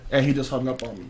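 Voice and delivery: normal talking voice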